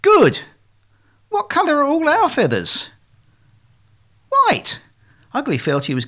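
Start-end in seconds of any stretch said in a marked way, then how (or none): 0.0-2.9 high, emphatic teacher-like swan; loud praise, then pointed question; exasperated quizzing. Acted. Exasperated leader quizzes duckling. 4.3-4.8 very high, eager one-word answer; eager pupil duckling, squeaky-high; pleased little duckling. Acted. Duckling proud of getting good.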